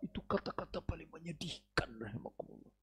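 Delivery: hushed, sorrowful whisper. Real, not acted. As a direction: breathe it out in grief. Penutup sedih yang lirih. (berbisik lirih penuh duka)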